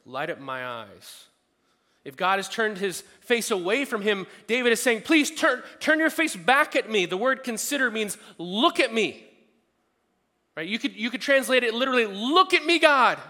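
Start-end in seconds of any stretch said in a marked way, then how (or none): 2.0-13.2 loud, high, driving preacher voice; full-force preaching. Real, not acted. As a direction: build the plea up until it rings.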